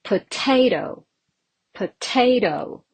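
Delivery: potato with a clear stress on TA; teacher's model of poTAto; stress on second syllable. Shown as a demonstration. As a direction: punch the middle syllable of potato. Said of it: "Definitely the second one".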